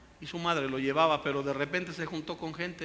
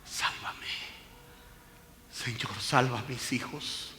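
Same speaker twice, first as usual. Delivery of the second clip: soft, lower, almost whispered plea; hushed imitated weeping prayer; tearful prayer; 3 semitones lower, 5 dB quieter than usual. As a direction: pray quietly through tears. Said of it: "cuando llorando le dices".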